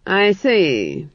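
mildly icy acknowledgement; cold. A narrator's reading. Duke responds to chamberlain's excuse, mildly cold. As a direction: give away nothing, coolly. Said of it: "he said coldly".

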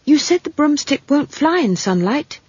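put-on high-pitched worried girl; young girl urgently objecting; urgent child. A narrator's reading. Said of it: "she said urgently".

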